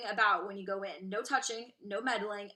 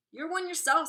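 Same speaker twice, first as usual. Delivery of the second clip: cheeky retort; 8 semitones higher, 8 dB louder than usual. Acted. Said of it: "said Mister Wonka".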